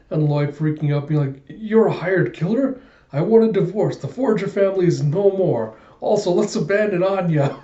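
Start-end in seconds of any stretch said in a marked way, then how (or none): 1.5-7.6 high, appalled outburst; acted freak-out. Husband freaking out over his wife's secret, high mock panic. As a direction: panic melodramatically at the discovery.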